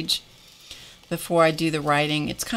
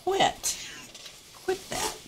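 much higher, squeaky little scolding voice; 7 dB quieter, slower than usual. Real, not acted. Sudden scolding interruption.

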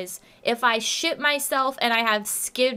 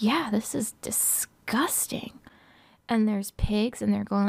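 mock sultry whisper; 4 semitones lower, 5 dB quieter than usual. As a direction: whisper it like pillow talk. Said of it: "And I'm like".

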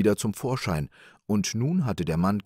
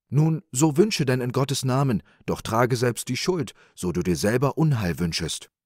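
a slightly raised, solemn warning; mildly stern angel, starting louder and higher; lightly voiced angel; 5 semitones higher, 4 dB louder than usual. A narrator's reading. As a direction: grant it with a firm warning.

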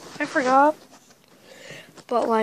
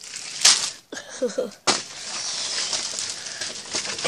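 whispered giggle; 6 dB quieter than usual. Real, not acted. Giggle after secret.

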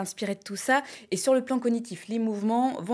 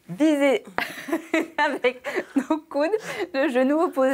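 laughing through demo; 6 semitones higher, 4 dB louder than usual. Real, not acted. Démonstration en riant.

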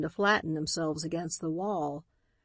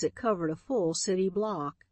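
plain reading voice

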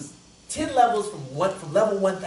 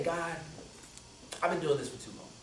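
lower, soft, confessing; hushed prayer; 4 semitones lower, 12 dB quieter than usual. Retold or imitated. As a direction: murmur it to God. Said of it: "I say".

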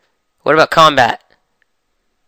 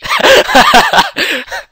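squeaky high-pitched laugh; giggling at the voice crack; 3 dB louder than usual. Real, not acted.

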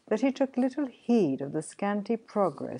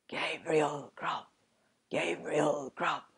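wild spectral chorus; 3 dB quieter than usual. A narrator's reading. Goblin chorus taunts the sexton, eerie chanting. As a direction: chant the name slowly like unseen spirits. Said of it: "screamed a wild chorus of voices".

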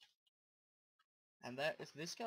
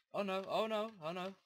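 panicked; 5 semitones higher, 5 dB louder than usual. Real, not acted. Zombie appears.

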